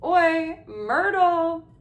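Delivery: loud, high bellow. A narrator's reading. Poltergeist calling the ghost girl over, bellowing. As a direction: holler across the room for her. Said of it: "He took a deep breath and bellowed".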